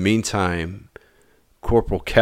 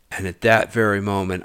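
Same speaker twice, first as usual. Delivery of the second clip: usual speaking voice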